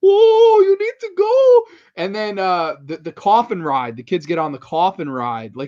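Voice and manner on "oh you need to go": very high, loud silly monster; tall monster voice. Retold or imitated. Guy imitating a seven-foot monster warning kids, high loud silly voice. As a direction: wail the warning way up high, starting on a big Oh. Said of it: "like, a seven-foot-tall monster".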